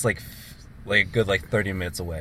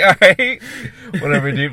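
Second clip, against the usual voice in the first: higher and louder, words broken by laughter; laughing; 10 semitones higher, 11 dB louder than usual. Retold or imitated. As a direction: laugh it off to the friend. Retold reply, still laughing.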